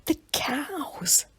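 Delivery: high breathless whispered cry; whispery cry. A narrator's reading. Millie suddenly spotting the cows, whispered cry. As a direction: gasp it out in a whisper. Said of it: "Millie gave a little whispery cry".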